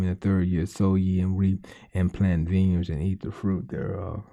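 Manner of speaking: low, soft scripture reading. Acted. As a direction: read it low and solemn.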